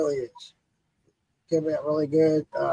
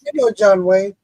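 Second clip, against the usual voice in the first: Egyptian locals; 2 semitones higher, 7 dB louder than usual. Retold or imitated. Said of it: "convert everything to John Wayne".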